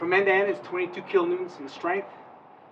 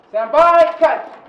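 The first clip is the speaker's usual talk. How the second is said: shouting; 9 semitones higher, 18 dB louder, much slower than usual. Real, not acted. Drill command called out.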